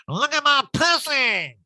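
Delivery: slow, loud, high crude announcement; hammed-up brazen call; brazen shout. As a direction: blurt it out high and drawn out. [name] grabs phone, crude shout.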